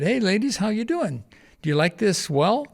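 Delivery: high, friendly hello to calm them; cheery greeting. Retold or imitated. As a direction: greet them sweetly to disarm them. Greeting upset ladies with cheer.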